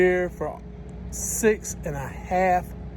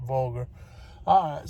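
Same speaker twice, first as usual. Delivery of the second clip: ordinary talk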